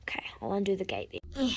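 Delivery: soft unvoiced whisper; resigned hush. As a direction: mutter it in defeat.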